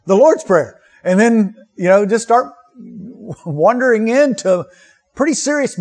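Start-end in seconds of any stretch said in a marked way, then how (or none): 0.0-0.8 mock dismissive. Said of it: "oftentimes we say".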